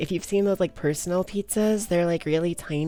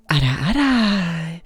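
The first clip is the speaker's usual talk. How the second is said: slow, louder teasing big-sister voice; teasing ara ara; 6 dB louder, slower than usual. Pretend. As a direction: tease them like a doting big sister. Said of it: "wanting these ara aras".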